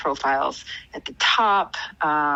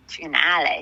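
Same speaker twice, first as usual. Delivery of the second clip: put-on Boston accent. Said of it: "can we give it a Boston accent".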